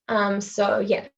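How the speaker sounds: ordinary talk, nothing marked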